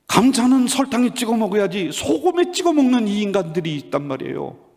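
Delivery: high-pitched mock outrage at the start, falling away; hammed-up courtroom grievance; acted indignant complaint. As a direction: play an exasperated wife complaining to a judge. Wife pleads her case in court.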